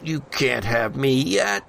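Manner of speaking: normal talking voice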